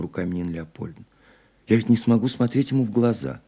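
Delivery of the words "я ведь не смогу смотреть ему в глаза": high, loud stage-play cry; theatrical exclamation. A narrator's reading. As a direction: declaim it like a line from a play. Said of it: "чуть театрально воскликнула она".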